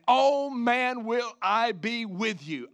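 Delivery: "oh man" loud and stretched, each word leaned on; slow, emphatic promise, pushing "oh man, will I be with you"; extreme emphasis. Acted. Preacher voicing Jesus promising to be with them, emphatic. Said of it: "a word of extreme emphasis".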